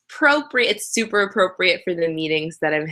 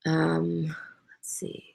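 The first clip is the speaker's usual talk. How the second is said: whispered thinking; 10 dB quieter, slower than usual. Real, not acted. Thinking while reading the questions.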